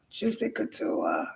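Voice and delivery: Juicy Couture dropped low and soft; hushed brand name. Shown as a demonstration. Showing off her Juicy Couture, dropped low and soft. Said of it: "I got some Juicy Couture".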